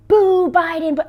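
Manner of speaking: high, loud playground boo; mock booing. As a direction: jeer like a pack of kids. Kids yelling while chasing.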